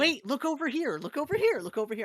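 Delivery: high, loud, excitable nagging; hammed-up insistent calling, easing off at the end; mock pestering. Mimics pestering player for attention.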